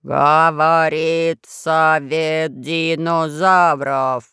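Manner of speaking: низкий хриплый, очень медленно; медленно, по слогам, хрипло; low hoarse voice. A narrator's reading. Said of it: "заговорил медленно по слогам". (низким хриплым голосом, по слогам)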